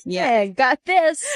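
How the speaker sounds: slow, smug and high. Retold or imitated. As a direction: drawl it like a lazy hack. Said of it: "forty-two-year-old in L A was like".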